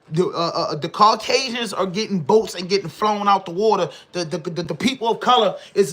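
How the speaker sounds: ordinary delivery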